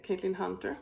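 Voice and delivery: usual speaking voice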